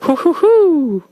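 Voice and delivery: high "hoo hoo hoo", last one held; read-out hooting. Acted. DJ voicing a Paraguay listener, high playful hooting. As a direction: act out typed hoots, pitched high. Reading out a listener's typed sound.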